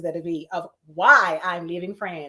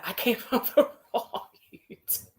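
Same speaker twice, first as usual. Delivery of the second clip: laughing through words; 2 semitones higher than usual. Real, not acted. Laughs at own mistake.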